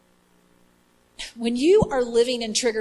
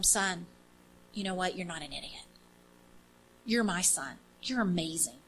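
much softer, lower, gentle reassurance; tender Jesus; 5 semitones lower, 10 dB quieter than usual. Retold or imitated. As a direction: speak softly like a loving father to a hurt child. Jesus speaking tenderly to him.

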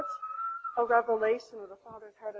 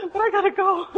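high and loud, through laughter; laughing protest; 9 semitones higher, 11 dB louder than usual. Retold or imitated. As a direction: laugh while insisting you must go. Torn between prayer and flight.

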